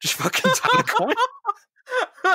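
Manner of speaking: very high, slow sobbing wail; mock crying wail. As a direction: wail it like a hammy sob. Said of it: "shaking him, going".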